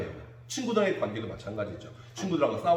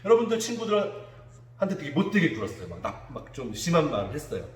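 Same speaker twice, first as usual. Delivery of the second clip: normal talking voice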